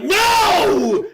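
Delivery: high, loud, drawn-out yell; shouted refusal. Retold or imitated. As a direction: bellow the refusal as a punchline.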